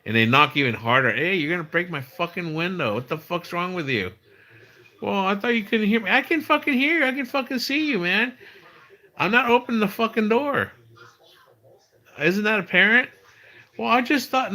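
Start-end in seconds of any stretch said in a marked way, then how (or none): 1.1-4.1 retold yell. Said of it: "I finally yell at them". 5.0-6.1 high put-on voice for an excuse; imitated salesman. 6.1-13.1 very high-pitched mock exasperation; retold angry yell. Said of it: "I finally yell at them". 13.8-14.5 high-pitched mocking excuse; imitated salesman.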